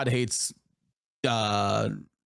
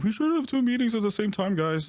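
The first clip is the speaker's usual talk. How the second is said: mocking whiner; 10 semitones higher than usual. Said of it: "people are gonna fucking chime in and be like".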